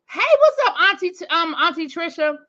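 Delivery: excited greeting. Real, not acted. Greets a chat regular warmly.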